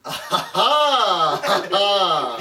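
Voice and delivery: slow, high, blaring "ha ha"; performed laugh thrown right at a stranger; loud fake laugh. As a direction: imitate an obnoxious booming cackle. Re-enacts laughing at strangers' faces.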